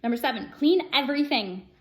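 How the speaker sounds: usual speaking voice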